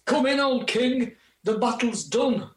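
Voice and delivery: normal talking voice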